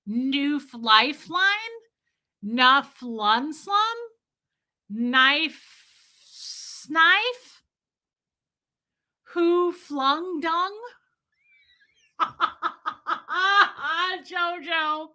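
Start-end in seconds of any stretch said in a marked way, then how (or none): 0.1-10.8 high, slow, puzzled sounding-out; mock mangled names. Mocking how she reads the chat's words. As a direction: drag out each garbled guess. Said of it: "I'm like".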